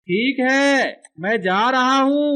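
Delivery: high, drawn-out announcement; animated character calling. Acted. Character telling someone he's leaving, drawn-out calling. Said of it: "अब ये बोलेगा".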